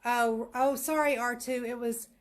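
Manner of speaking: usual speaking voice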